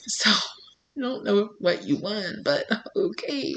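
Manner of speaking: soft, slow, laughing aside; amused aside. Real, not acted. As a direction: mutter it amused.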